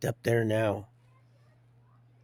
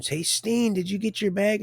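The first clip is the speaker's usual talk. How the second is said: higher voice calling across the unit; imitating a fellow inmate calling out; 9 semitones higher, 3 dB louder than usual. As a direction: mimic someone calling out to him. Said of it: "and they're like".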